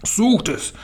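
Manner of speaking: high imperious yell; shouted command. A narrator's reading. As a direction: order the hunt in a high, strained voice. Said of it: "schrie die Hoch- und Großmeisterhexe".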